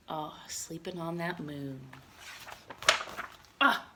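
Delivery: ordinary talk, nothing marked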